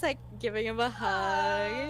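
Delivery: ordinary talk, nothing marked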